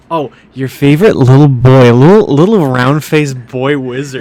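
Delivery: put-on loud sarcastic baby-talk gushing; mocking cutesy. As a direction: gush mockingly about the boy wizard. Mocking the announcement's cutesy wording.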